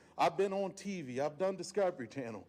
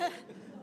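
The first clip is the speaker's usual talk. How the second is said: embarrassed laugh; 7 dB quieter than usual. Real, not acted. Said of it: "why am I getting emotional".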